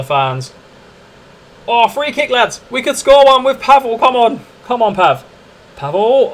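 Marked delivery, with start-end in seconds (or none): 1.7-6.3 much higher and louder, urging it in; excited. Real, not acted. Gamer begging his free kick to go in, excited. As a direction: cheer the free kick on like it matters.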